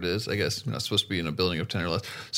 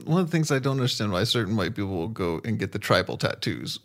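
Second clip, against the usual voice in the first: ordinary talk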